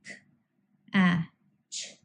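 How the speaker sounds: "k, a, ch" as three separate sounds of catch, pauses between; phonemes sounded out. Shown as a demonstration. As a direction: sound out "k", short "a" as in cat, then "ch", each alone. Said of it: "actual pronunciation of three phonemes".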